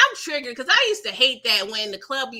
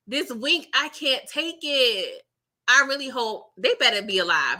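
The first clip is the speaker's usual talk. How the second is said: ordinary talk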